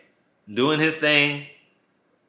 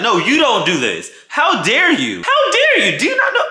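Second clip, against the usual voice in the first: rising high and louder, laughing; indignant; 9 semitones higher, 4 dB louder than usual. Retold or imitated. Telling off the cheater, climbing high indignation. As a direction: climb higher on each how dare you. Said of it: "And I'm like".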